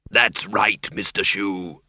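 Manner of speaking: low, cheerful creature voice; deep, pleased bogeyman; happy bogeyman. A narrator's reading. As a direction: answer happily as a deep-voiced monster. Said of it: "said Schleppel happily".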